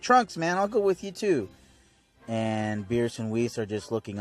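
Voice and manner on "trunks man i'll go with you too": eager Goku voice. Retold or imitated. Goku voiced in episode recap.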